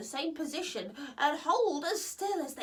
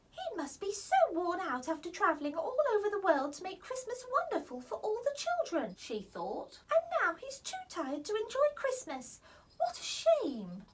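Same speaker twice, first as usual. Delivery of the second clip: lightly voiced young fairy, pitying; a fairy feeling sorry, a little higher; gentle fairy Holly; 7 semitones higher than usual. Holly thinking about tired Santa, lightly pitying.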